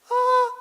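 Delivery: falsetto ah. Shown as a demonstration. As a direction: flip into falsetto on ah. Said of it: "lo puedo hacer también en falsete". (ah en falsete)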